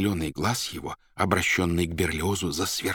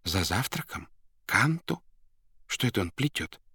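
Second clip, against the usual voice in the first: hushed baffled inner questions; bewildered thought; 3 semitones higher than usual. A narrator's reading. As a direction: wonder silently, taken aback. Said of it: "подумал он". (недоумённо, про себя)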